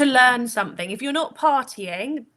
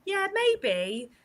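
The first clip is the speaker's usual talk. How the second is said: light, high, hesitant maybe; high-pitched, half-convinced reply; noncommittal Gemini; 9 semitones higher than usual. Acted. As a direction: lightly act out a wavering Gemini. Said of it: "said that Gemini will go".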